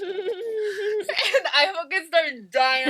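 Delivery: cracking up while talking; drawn-out, laugh-filled telling. Real, not acted. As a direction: squeeze the words out through real laughter.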